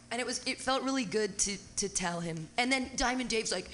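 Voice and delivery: usual speaking voice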